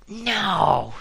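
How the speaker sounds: woman shouting at her slow friend in exasperation; exasperated shout. A narrator's reading. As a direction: snap it out, fed up. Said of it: "she shouted in exasperation".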